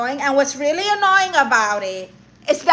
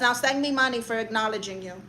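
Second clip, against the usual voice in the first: haughty persona; 3 semitones lower, 7 dB quieter than usual. Pretend. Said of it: "That's my whole persona on the internet".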